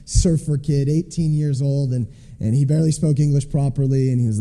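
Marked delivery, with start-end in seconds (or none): none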